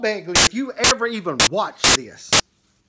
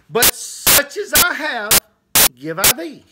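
slow, raised scripture declaration; preacherly proclamation; 5 semitones higher, slower than usual. Acted. Minister quoting Peter to the lame man, slow and proclaiming. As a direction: proclaim it, stretching out each phrase.

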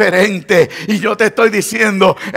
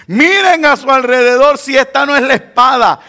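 calling out, mildly raised voice; retold shout; 6 semitones higher, 5 dB louder than usual. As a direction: imitate David calling across a distance. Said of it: "y le grita".

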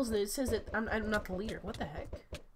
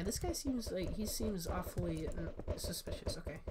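ordinary talk, nothing marked

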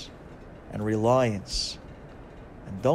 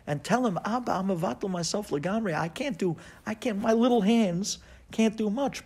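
higher, earnest pleading; pleading exhortation; 8 semitones higher than usual. Acted. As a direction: urge them gently but intensely.

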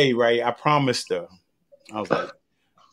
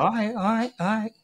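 mom letting it go; 10 semitones higher than usual. Retold or imitated. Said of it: "she's like".

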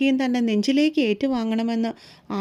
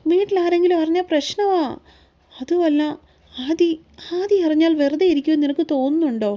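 higher-pitched, anxious questioning; mildly fearful, raised pitch; worried; 4 semitones higher than usual. A narrator's reading. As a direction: fret about being found out. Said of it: "അനാമികയ്ക്ക് കൂടുതലും പേടിയായിരുന്നു".

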